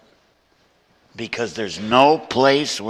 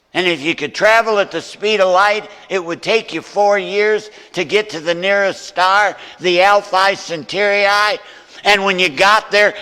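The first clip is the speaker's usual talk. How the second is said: rising preaching climax; 10 semitones higher, 9 dB louder than usual. Acted. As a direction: build and hammer the final line. Building to sermon climax.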